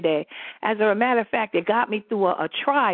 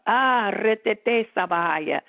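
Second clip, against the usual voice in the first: speaking in tongues; slower than usual. Real, not acted. Praying in tongues mid-sermon.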